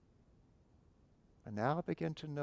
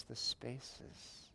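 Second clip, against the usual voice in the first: soft trailing words; 2 semitones higher, 7 dB quieter than usual. Acted. Closing meditation phrase drifting off.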